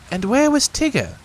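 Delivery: high brisk rabbit; busy rabbit asking. Acted. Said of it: "asked Rabbit".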